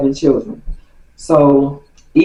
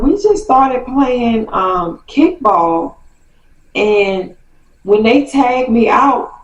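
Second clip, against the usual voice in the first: put-on whiny kid; 8 semitones higher, slower than usual. [name] explaining, hurt.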